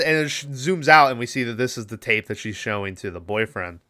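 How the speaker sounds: ordinary delivery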